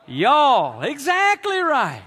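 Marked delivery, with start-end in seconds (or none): 0.1-0.8 broad Alabama drawl on y'all, loud; Southern y'all. Shown as a demonstration. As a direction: say "y'all" as one Southern syllable. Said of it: "You" plural is".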